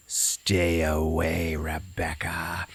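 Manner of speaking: slow whispered ghost voice; ghostly whisper. Retold or imitated. Ghost Diana warning Rebecca, slow eerie whisper. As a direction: breathe it out slowly and menacingly. Diana just starts talking.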